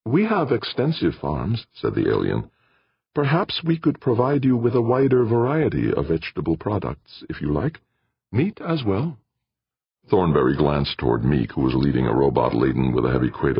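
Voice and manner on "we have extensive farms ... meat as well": polite alien voice. Acted. Alien offers food.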